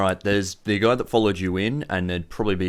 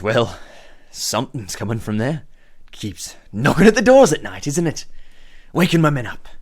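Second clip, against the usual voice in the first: higher, louder mock grievance; aggrieved gang boss; 6 semitones higher, 5 dB louder than usual. Boss complains of night noises to visitors, loudly aggrieved. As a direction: complain with rising theatrical indignation.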